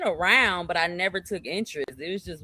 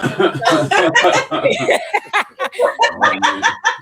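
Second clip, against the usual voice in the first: unvoiced high cackle of a laugh; high loud laugh; 11 semitones higher, 8 dB louder than usual. Real, not acted. Laughing hard at her own story, high loud cackle. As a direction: burst out laughing.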